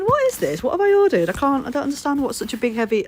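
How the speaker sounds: high-pitched, hammed-up confusion, settling gradually; mock bewilderment. Telling viewers about opening a mystery package, mock bewilderment. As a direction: act baffled in a rising squeal.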